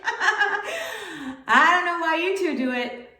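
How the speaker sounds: slow, laughing through the words; laughing grandma. Retold or imitated. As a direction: chuckle out the answer as a grandma amused by her family. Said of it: "She's laughing and she's like".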